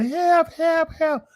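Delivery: high-pitched mocking help, help; mock cry for help. Mocking the woman stuck in the hole, high mock holler. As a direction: squeal it like a helpless victim. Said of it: "And then you holler".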